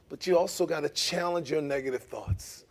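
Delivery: normal talking voice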